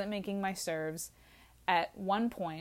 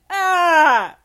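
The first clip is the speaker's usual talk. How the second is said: loud, much higher panicked scream; startled scream; 10 semitones higher, 16 dB louder than usual. Retold or imitated. Drunk Sam hearing her name, screaming in panic. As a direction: shriek as if caught.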